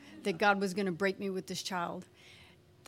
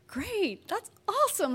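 higher, cheery reply, imitated; upbeat husband; 10 semitones higher than usual. Husband hearing she thinks she's pregnant, imitated upbeat. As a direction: put on a pleased husband's reply. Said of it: "And he's like".

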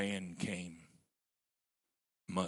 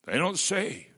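emphatic preaching; 9 semitones higher, 10 dB louder than usual. Real, not acted. Preacher's rising emphatic point.

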